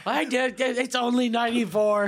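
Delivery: high, slow hammed-up voice with laughs; mock rant. Friend mocking the angry call, high silly voice.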